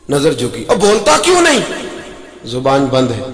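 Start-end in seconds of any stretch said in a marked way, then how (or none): 0.7-1.6 put-on stern uncle shouting down at the boy; angry uncle. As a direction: bark it at the nephew like a furious uncle. Uncle confronts his nephew.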